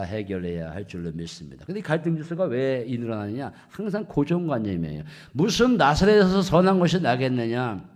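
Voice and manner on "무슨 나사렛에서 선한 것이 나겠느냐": louder, emphatic sermon delivery; forceful preaching. Real, not acted. Preacher restates Nathanael's prejudice against Nazareth, preaching forcefully. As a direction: hammer the quoted objection home to the congregation.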